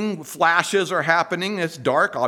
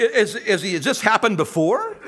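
ordinary talk

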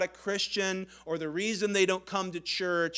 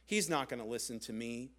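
much lower and softer, hopeless; inner thought; 8 semitones lower, 9 dB quieter than usual. Retold or imitated. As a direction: mutter to yourself, defeated. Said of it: "thought to himself".

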